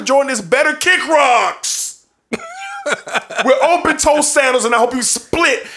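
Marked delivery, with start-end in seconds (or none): 2.3-3.4 a quick high laugh. Real, not acted. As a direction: laugh at your own joke. Laughs at own insult.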